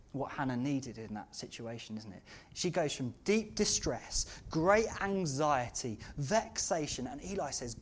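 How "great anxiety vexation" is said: normal talking voice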